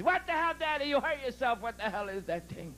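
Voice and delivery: high, startled outburst, falling as it goes; panicked little boy, acted; frantic kid. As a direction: blurt it like a kid going crazy. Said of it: "The little kid went crazy".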